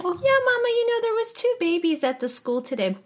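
put-on very high tiny toddler voice; little baby voice. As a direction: squeak it like a little girl. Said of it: "little baby voice".

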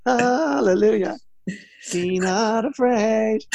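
very high-pitched sportswoman impression; Katie Taylor. Mimic showing anyone can do the boxer, high voice. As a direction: pitch it way up, stretch out the hallelujahs. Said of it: "everyone could do a Katie Taylor".

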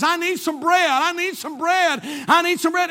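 high, loud insistent chant; relentless nagging. Retold or imitated. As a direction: pester loudly without letup. Acting out repeated nagging email.

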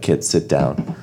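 low, quiet and grave; sit them down gently, serious; solemn parent voice. Pretend. Parent breaking bad news to kids.

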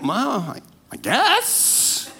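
unsure, trailing off, then a lifted guess; hesitant shrug. Retold or imitated. Preacher mimicking his doubt that God is joyful, hesitant. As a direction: hedge it like you're not sure. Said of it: "I was like".

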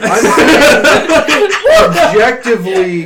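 slow, laughing protest. Real, not acted. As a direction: laugh through the words. Laughing defence under teasing.

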